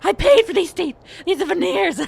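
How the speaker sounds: high, loud frantic wail about his teeth; panicked wail. Acted. Game master voicing a doctor panicking over his teeth, high frantic wail. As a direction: shriek it in total panic. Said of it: "He's like".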